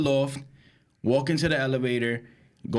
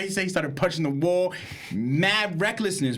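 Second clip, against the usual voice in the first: normal talking voice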